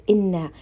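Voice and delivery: "Inna" with a nasal doubled n; common mistake. Shown as a demonstration. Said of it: "many sisters are reading as".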